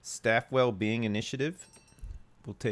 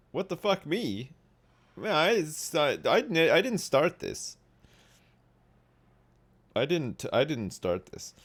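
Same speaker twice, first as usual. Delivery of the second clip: protesting suspect; 6 semitones higher than usual. Pretend. Acted arrested man protesting.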